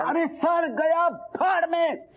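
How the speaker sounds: high, drawn-out exasperated cry; angry outburst. Acted. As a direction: cut them off, stretching the words in fury. Furious at missing money.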